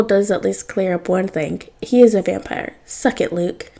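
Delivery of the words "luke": plain reading voice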